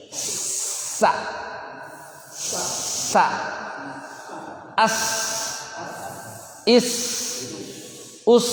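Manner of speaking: isolated syllables with a long hissing s; makhraj sa. Shown as a demonstration. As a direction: drill "Sa", then "As, Is, Us" holding the s. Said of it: "makhraj sa di mana nih". (latihan bunyi sin dengan desis panjang)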